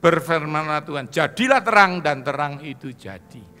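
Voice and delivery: loud, rising proclamation of Genesis; scripture reading at full force. Acted. Preacher to congregation, loud proclamation. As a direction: read the creation verses like a preacher thundering.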